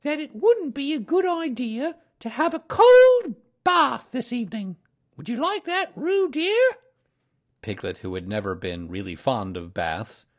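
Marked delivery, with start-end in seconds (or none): 0.0-6.8 very high mother-kangaroo voice; high-pitched pondering mother animal; thoughtful. A narrator's reading. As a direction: muse aloud up high, then ask the child. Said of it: "said Kanga in a thoughtful voice".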